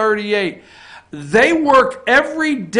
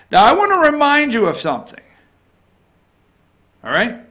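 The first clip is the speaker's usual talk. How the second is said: ordinary talk, nothing marked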